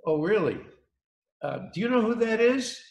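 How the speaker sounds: lightly voiced boss, high and teasing; a mock-amazed question from the owner; incredulous boss. Owner asking the new warehouse kid, high and mock-amazed. Boss asks if he knows her.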